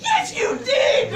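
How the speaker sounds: high, loud, slow laughing shout; retold yell. Daughter yelling back at her denying mother, laughing shout.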